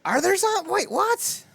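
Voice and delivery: very high-pitched startled question; real disbelief. Real, not acted. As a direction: break off, baffled, voice shooting way up. Startled by claim of zombies.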